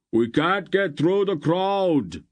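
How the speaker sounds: high, slow, loud shout. A narrator's reading. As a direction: yell it over the noise. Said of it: "Barak shouted".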